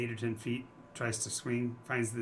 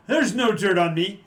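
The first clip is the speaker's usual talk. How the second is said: loud mock boast; 6 semitones higher, 11 dB louder than usual. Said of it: "He's going".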